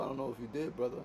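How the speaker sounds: ordinary talk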